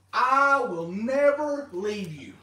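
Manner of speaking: slow, weighty quote. Retold or imitated. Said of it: "God said".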